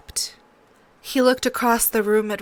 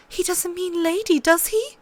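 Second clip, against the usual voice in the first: put-on much higher, scared girl's voice; a little girl afraid of the answer; frightened young Sansa; 10 semitones higher than usual. A narrator's reading.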